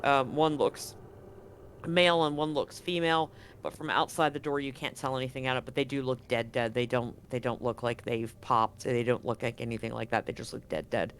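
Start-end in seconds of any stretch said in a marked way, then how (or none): none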